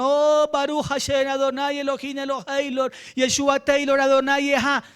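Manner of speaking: loud, high mock-Hebrew praying; showy pious prayer. Preacher mocks someone showing off Hebrew to seem spiritual, showy pious prayer. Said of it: "Ay, tan espiritual".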